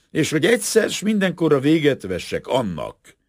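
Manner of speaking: high, loud, resolute declaration. A narrator's reading. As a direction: proclaim it forcefully, like a statement of conscience. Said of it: "emelt hangon".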